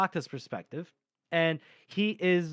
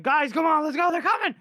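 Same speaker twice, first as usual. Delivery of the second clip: high, raised mock alarm; urgent calling out; 11 semitones higher, 4 dB louder than usual. Officer warning soldiers of attackers, urgent shout. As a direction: call the men to action, high and urgent.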